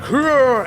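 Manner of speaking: high mock roar, falling off; high-pitched "hurrah" roar in imitation; imitated battle roar. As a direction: imitate the attacker's yell. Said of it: "He was like".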